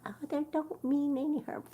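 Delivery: high, sugary mock-kind voice; mock-gentle dismissal pitched high; mock nice. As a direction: play the sweet pushover with a high fake niceness. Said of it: "I can be really nice and go".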